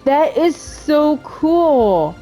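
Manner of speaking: slow, high, drawn-out marvelling; impressed, lingering and high; awed enthusiasm. Real, not acted. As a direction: stretch every word in admiration. Marvels at the 2D style.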